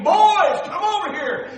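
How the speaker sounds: put-on loud, high shout to the kids; big excited retold yell; screamed call. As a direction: holler across the neighborhood. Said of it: "and he screamed".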